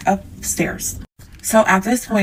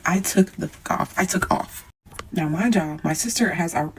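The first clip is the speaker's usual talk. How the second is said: normal talking voice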